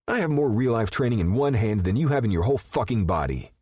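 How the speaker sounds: high and loud, in his face; furious teen getting in Rip's face; angry teen. A narrator's reading. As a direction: shove the words at him hotly. Boston gets in his face.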